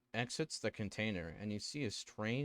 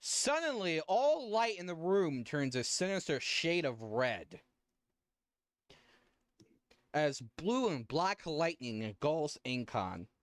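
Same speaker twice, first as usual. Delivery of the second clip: dramatic narration; 6 semitones higher, 4 dB louder than usual. A narrator's reading.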